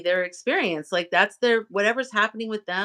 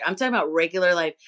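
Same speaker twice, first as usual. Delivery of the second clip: normal talking voice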